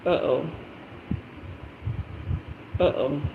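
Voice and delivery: soft, low uh-oh under the breath; quiet worried uh-oh. Retold or imitated. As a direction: murmur it in dread. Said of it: "And I'm like".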